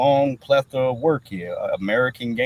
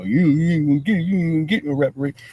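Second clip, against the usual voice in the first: higher, fast dismissive taunt; mock politician; 5 semitones higher, faster than usual. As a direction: toss it off like a smug politician. Mocking politicians' dismissive line.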